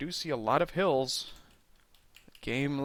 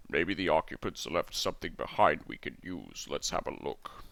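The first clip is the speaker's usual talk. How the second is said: much lower, softer character voice; low, subdued figure voice; Gorath; 9 semitones lower, 6 dB quieter than usual. Acted. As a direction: drop right down into a deep voice. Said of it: "oh, that's Gorath".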